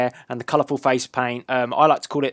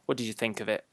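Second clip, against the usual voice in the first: ordinary delivery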